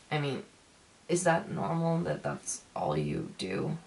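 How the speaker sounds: ordinary talk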